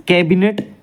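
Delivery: normal talking voice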